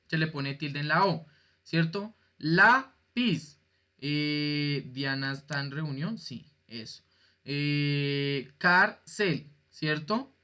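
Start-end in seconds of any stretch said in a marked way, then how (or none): 2.4-3.6 "Lápiz" as "lá... piz", high, loud first beat; split into syllables. Shown as a demonstration. Teacher showing students the penultimate accent, "Lápiz" syllable by syllable. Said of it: "Tiene dos sílabas". 8.6-9.5 "cárcel" as "cár... cel", weight on the first; split into syllables. Shown as a demonstration. Teacher illustrating penultimate stress, "cárcel" syllable by syllable. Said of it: "Penúltima sílaba".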